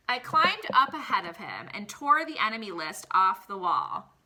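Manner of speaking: storybook read-aloud voice for the class; expressive picture-book reading; reading to children. Acted. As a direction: read it like story time for kids. Teacher reads picture book aloud.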